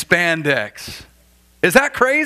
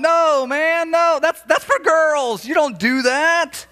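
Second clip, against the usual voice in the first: high, slowing protest of no, man; a high incredulous objection; mock disbelief; 11 semitones higher, slower than usual. Preacher reacting to guys in eyeliner, high mock protest.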